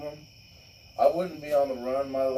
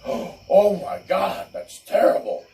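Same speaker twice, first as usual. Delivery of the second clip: mock shocked judge; 7 semitones higher, 10 dB louder than usual. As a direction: play the judge as hammed-up horrified. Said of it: "the judge is like".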